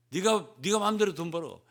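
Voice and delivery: a hammed-up harsh refusal; gruff brush-off. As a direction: bark it like a stingy husband. Law voiced as harsh husband.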